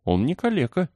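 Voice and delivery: put-on girl's high protesting exclamation; crying out. A narrator's reading. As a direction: leap up in pitch to defend him. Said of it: "выкрикнула и Мэри".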